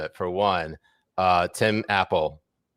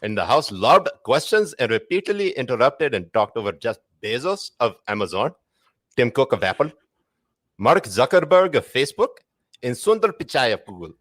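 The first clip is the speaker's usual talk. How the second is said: Pakistani accent; 3 semitones higher than usual. Shown as a demonstration. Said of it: "read this shit in a Pakistani accent".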